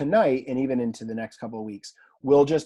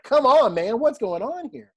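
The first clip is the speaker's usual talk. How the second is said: high, loud exasperated protest; heated exasperation; 9 semitones higher, 7 dB louder than usual. Retold or imitated. Worked-up reader protesting a translation, heated and exasperated. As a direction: burst out, worked up and incredulous. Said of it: "My wife was telling me to calm down".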